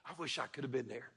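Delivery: soft, low, wistful longing; quietly wishing to have been there; hushed wistful sigh. Retold or imitated. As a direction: breathe it out like a dreamer.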